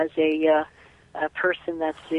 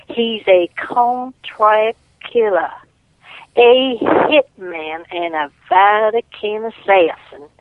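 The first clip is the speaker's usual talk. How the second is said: slow, higher Southern belle accent, lightly put on; 3 semitones higher, slower than usual. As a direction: play a Gone with the Wind Southern lady in her accent. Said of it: "let me get my accent".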